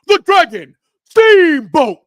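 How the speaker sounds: booming, drawn-out ring announcer call; Howard Finkel ring announcement. Retold or imitated. Introducing the champion to the fans as a ring announcer. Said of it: "I'll say it like Howard Finkel".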